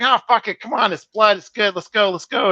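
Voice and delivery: high, loud tough-guy brush-off; Michael brushing it off. Retold or imitated. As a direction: play it like a bleeding star who wants to keep shooting. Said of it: "Michael's like".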